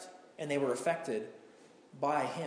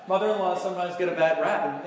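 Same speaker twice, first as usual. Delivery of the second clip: amused, laughing; 3 semitones higher, 5 dB louder than usual. Real, not acted. Joking about mothers-in-law, laugh heard.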